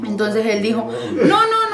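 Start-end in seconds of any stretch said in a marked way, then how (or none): 1.1-1.7 high laughing brush-off; nervous laughing dismissal. Retold or imitated. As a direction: laugh it away, squeaky and high. Boss brushing off question, laughing.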